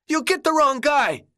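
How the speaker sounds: high, panicked shriek. Acted. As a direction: shriek it like a cornered madman. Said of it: "Ben shrieked".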